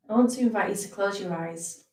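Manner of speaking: unhurried meditation teacher, leaving space between phrases; guided meditation. Acted. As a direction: ease listeners into rest, phrase by phrase. Leads a guided body scan.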